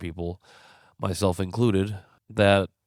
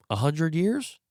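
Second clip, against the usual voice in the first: rising higher and higher in disbelief; can't believe the numbers, each guess climbing higher; incredulous questions; 11 semitones higher than usual. Real, not acted. Host asking his audience in rising disbelief, pitched high. Host doubting Bears leaving Chicago.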